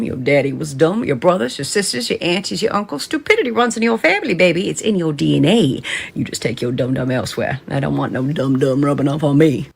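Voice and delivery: ordinary talk, nothing marked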